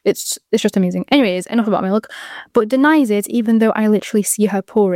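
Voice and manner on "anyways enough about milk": usual speaking voice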